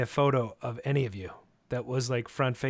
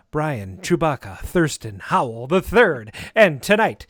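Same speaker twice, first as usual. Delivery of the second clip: upbeat announcer voice, louder; podcast host intro; 2 semitones higher, 7 dB louder than usual. Acted. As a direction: welcome listeners like a radio host.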